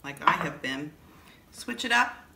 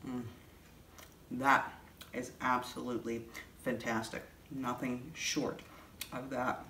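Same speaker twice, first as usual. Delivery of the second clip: talking while chewing bread; mouth full; 4 semitones lower, 6 dB quieter than usual. Real, not acted. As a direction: speak through a mouthful. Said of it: "Sorry to talk with my mouth full".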